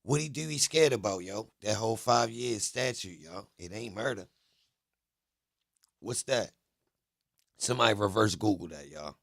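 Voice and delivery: loud disbelief. Real, not acted. Streamer appeals to viewers about the statute, loud and incredulous. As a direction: call out to the audience, baffled and insistent. Agitated appeal to listeners over clip.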